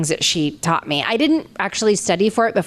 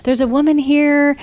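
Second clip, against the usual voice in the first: psychic voice; 8 semitones higher, 5 dB louder than usual. Retold or imitated. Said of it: "the guy said".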